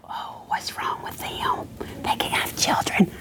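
hushed, slow stage whisper; gossipy whisper. Retold or imitated. Church people speculating about a childless couple, whispered.

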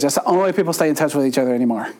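rattled off very fast; coffee-fast talker. Retold or imitated. Friend John urging Facebook, very fast. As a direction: rush it like too much coffee. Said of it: "He talks just like that 'cause he drinks a lot of coffee".

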